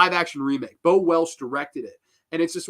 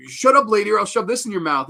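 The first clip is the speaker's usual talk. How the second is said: louder, higher imitated villain threat; Fat Stuff; 6 semitones higher, 6 dB louder, faster than usual. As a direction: threaten her as a movie heel. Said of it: "their lines are, like, Fat Stuff's".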